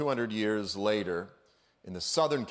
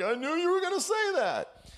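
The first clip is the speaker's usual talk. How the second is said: much higher, teasing cartoon voice; cartoon God comeback. Preacher voicing God one-upping Satan, high teasing cartoon voice. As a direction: toss it back smugly, way up high. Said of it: "And the Lord's like".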